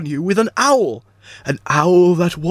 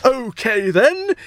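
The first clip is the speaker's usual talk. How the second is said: usual speaking voice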